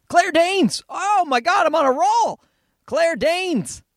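high, triumphant exclamation; excited recall. Real, not acted. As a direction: blurt it out high, on a roll. Finally remembers the name, elated.

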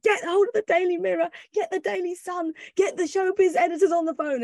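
high, eager rapid orders; excited boss. Retold or imitated. The editor calling the tabloids, excited orders. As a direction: bark orders in a rush of excitement. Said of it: "he was so excited".

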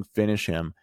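ordinary talk

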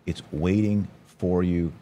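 normal talking voice

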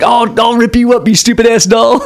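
high hammed-up threat to a doll; mock threatening dad.

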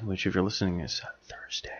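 quick whispered aside; whispering. Real, not acted. Aside about release day.

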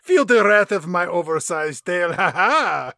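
gloating, loud, laughing alligator villain. Acted. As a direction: shout the triumph like a cartoon monster. Ramon gloating after tail attack.

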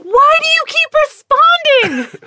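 high, slow, loud exasperated husband voice; exasperated Steven. Retold or imitated. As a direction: whine it like a fed-up husband. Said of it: "Steven's like".

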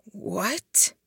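baffled, rising question; high, breathy puzzlement; bewildered. A narrator's reading. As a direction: ask it in utter confusion. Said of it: "he asked, bewildered".

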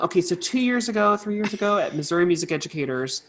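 usual speaking voice

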